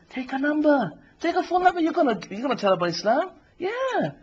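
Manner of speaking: high-pitched, persuasive tempter; Shaitan. Acted. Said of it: "And then the shaitan says".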